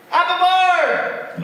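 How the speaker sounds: slow, high, loud laughing bug; the bug chuckles again. Acted. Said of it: "laughed the bug".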